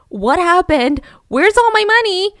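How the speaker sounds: mock panic climbing high and loud; alarmed outrage. Husband calling his bank about missing money, alarmed. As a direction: act out a frantic demand, building.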